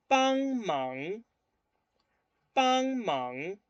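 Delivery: teacher models Mandarin tones. Shown as a demonstration. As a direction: model the tones on "Pan, man" for the class. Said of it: "Colóquenle el tono".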